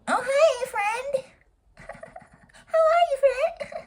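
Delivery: very high, falsely sweet voice; fake sweet greeting. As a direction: chirp it with fake friendliness. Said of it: "be like".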